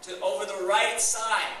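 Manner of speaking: slow, high, loud call across water; yelled instruction. Retold or imitated. Jesus shouts to disciples fishing offshore, slow yell. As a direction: holler it out, drawing the words long. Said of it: "he yells to them".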